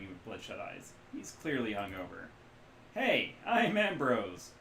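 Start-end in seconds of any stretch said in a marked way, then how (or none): 2.9-4.5 loud, slow, cheery greeting; cheerful shout. Acted. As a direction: boom out a happy hello. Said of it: "he shouts cheerfully".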